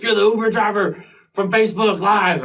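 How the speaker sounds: imitating people spotting him at the mall; excited fans. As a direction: fans blurting it out in surprise. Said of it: "They were like".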